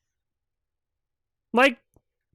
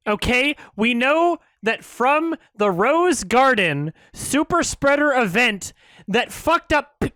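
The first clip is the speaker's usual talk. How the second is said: ordinary delivery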